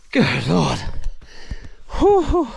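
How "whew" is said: high whew. Real, not acted. Hiker on steep steps, puffing a real high whew. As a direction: puff out a sharp high whew.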